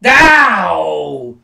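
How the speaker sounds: pained ow. Real, not acted. Reacting to chair shot.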